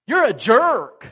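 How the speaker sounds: loud, slow, high shouted insult; angry accusation. Retold or imitated. A buddy shouting at the husband across the table, furious. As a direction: throw it at him with disgust. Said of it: "the guy looks at him and said".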